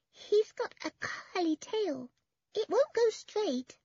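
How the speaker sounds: put-on very high, slow little-child voice; small boy. A narrator's reading. Small boy shows off the piglet's tail, small child's voice. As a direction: explain it like a five-year-old. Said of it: "said the small boy".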